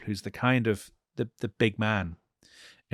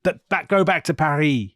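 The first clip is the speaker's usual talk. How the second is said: raised, higher mock chant; crowd shout; 5 semitones higher, 5 dB louder than usual. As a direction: imitate a shouting crowd, not full force. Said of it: "people start to shout".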